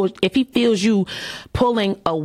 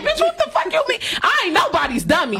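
squealing with laughter, mock offended; half-laughing, pretend indignant yell; mock outrage; 14 semitones higher than usual. Retelling her FaceTime reply to him, mock outraged laughing.

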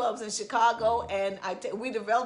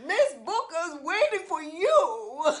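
high, slow coy character voice; Miss Booker voice; 8 semitones higher, 4 dB louder, slower than usual. Acted. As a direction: purr it as the stage lady. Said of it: "Skillet and Booker".

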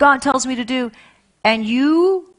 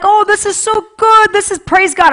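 blissful Moses; 10 semitones higher, 5 dB louder than usual. Retold or imitated. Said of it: "Moses is like".

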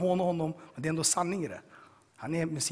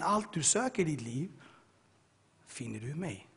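voice of God; 5 dB quieter than usual. Retold or imitated. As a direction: let the promise sink down to a hush. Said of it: "Andra gången så säger han".

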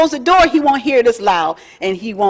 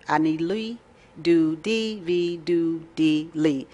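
"Ani li do di vi do di li" softer and slower still, almost reverent; even slower, softer Hebrew; 4 semitones lower, 7 dB quieter, slower than usual. Shown as a demonstration. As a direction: savour "Ani li do di vi do di li" syllable by syllable, quietly. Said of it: "That's as fast as I can say it".

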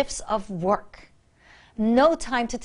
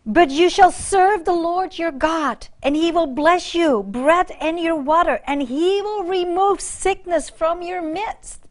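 raised proclaiming reading of scripture; 4 semitones higher than usual. Acted. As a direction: declare the verse to the church. Reads Exodus in preaching voice.

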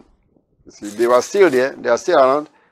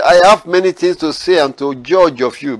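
higher, louder proclaiming of the verses; preaching at full force; 5 semitones higher, 6 dB louder than usual. Acted. Preacher proclaiming Jesus' answer to the Pharisees, forceful. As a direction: lift the words of Jesus over the church. Reading scripture.

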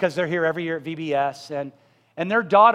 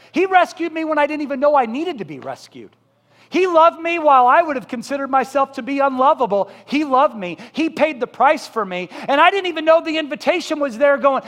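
higher and louder, building passion; fervent preaching; 7 semitones higher, 6 dB louder than usual. Acted. Pastor testifies to congregation about being rescued, fervent. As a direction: preach your own rescue with full fire.

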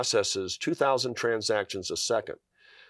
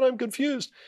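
much higher, echoing the doctor's words in wonder; much higher amazed repeat of the doctor's words; 14 semitones higher than usual. Retold or imitated. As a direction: lift it way up, stunned it's coming true.

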